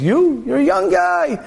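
high, mocking Yetzer Hara; scoffing tempter. Evil inclination dismissing a young man's projects, high mock scorn. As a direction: laugh off the young man's plans. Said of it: "the H R comes and says".

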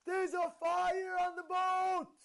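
high, drawn-out shout of alarm; imitated scream, long and high. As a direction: cry it out slowly at the top of your lungs. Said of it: "He screamed on top of his lungs".

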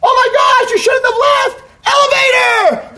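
high, full-voiced yell, last word drawn out; huge high-pitched holler; scream. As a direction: scream it down the steps to a friend. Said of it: "he screams down".

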